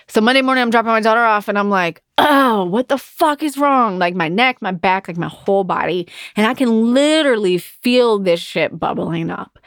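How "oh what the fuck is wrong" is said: higher, drawn-out exasperated outburst; mock-distressed, stretched and pitched up; retold exasperation. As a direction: act out a fed-up groan of a question. Retells exasperated moment at drop-off.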